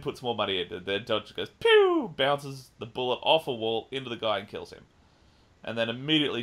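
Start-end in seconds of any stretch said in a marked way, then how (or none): 1.6-2.1 high loud mouth-made pew; gunshot pew. Retold or imitated. Describing the ricochet kill, high pew sound effect. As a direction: fire off a cartoon gunshot sound.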